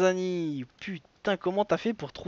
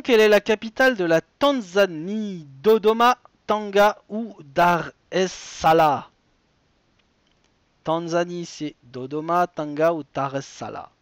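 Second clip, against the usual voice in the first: animateur de quiz qui énonce la question et les choix; présente chaque réponse comme un jeu télé; lecture de quiz; 5 dB louder than usual. Acted. Host reads quiz question. (voix d'animateur de jeu, un peu plus fort)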